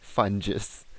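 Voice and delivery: FUNGUS as "fun-jiss", rhyming with sponges; soft-g pronunciation. Shown as a demonstration. Host repeating the joke word to co-host, soft-g pronunciation. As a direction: put a j in "Fungus". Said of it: "It rhymes with sponges".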